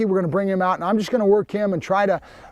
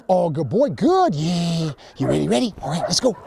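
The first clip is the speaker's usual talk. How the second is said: playful dog-talk, swooping praise; pumping up the dog. Real, not acted. Trainer getting his dog ready, playful hyping voice. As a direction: egg the dog on to get going.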